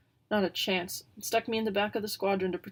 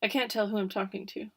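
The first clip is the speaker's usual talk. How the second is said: ordinary delivery, nothing marked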